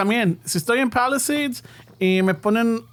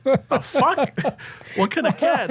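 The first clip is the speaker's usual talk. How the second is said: incredulous retort; 6 semitones higher, slower than usual. Retold or imitated. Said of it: "I'm like".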